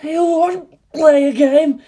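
slow, high and loud monster voice; a warped demon taunting its prey; screwed voice. Acted. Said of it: "she speaks in screwed voice".

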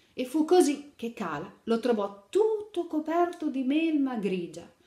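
put-on lively read-aloud to little children; animated picture-book narration; storytelling. A narrator's reading. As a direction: tell the fairy tale brightly to kids.

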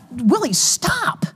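self-command. Retold or imitated. Runner telling himself to quit stewing, exasperated self-command. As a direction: pull yourself up with a long, high STOP.

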